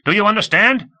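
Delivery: shouting straight into the microphone; irritated shout. A narrator's reading. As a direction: bark the order in exasperation. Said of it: "Komov shouted with irritation".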